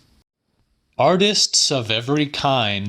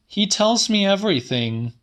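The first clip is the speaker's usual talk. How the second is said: Celia; 10 semitones higher, faster than usual. A narrator's reading. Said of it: "said Celia".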